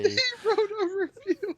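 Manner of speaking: very high, slow squeaky voice; slow falsetto joke voice; mock falsetto. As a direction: squeak it out slowly.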